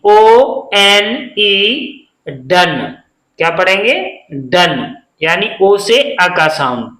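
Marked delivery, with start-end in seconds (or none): 0.1-2.1 slow spelling. Shown as a demonstration. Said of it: "spelling out letters".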